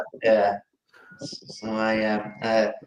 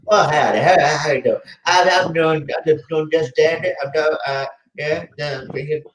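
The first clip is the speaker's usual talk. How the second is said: higher, louder hammed-up Southern howdy voice; American Southern accent; 6 semitones higher, 6 dB louder than usual. Guest doing requested American impression, hammed-up Southern accent. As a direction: play the good ol' boy cowboy. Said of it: "do your best American voice impression".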